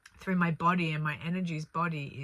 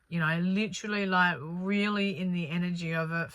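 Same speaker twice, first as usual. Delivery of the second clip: ordinary delivery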